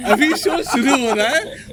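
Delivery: slow words through real laughter; giggling while trying to start the show; laughing. Real, not acted. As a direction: laugh your way through the line. Host repeats, still laughing.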